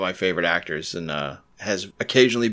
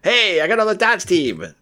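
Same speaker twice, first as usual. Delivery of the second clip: retold Massachusetts brag, louder and higher, darts as dahts; Boston accent; 9 semitones higher, 4 dB louder than usual. Student telling friends in England, Boston accent. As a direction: proudly announce it with a Boston accent. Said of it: "old Massachusetts accent".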